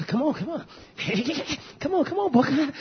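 high, gleeful taunt to come fight; taunting wrestler. Retold or imitated. Michael McGill daring a boy to fight, high gleeful taunting. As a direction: goad him in like a cocky schoolboy. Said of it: "old Michael McGill, boy, he would".

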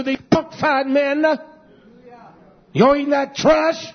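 high, loud fiery sermon delivery; full-force preaching. Acted. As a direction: drive it home from the pulpit.

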